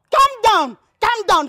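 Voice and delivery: high, loud echo of his words; angry retort. Acted. Girl throws man's plea back, angry retort. Mocking his calm down, angrily.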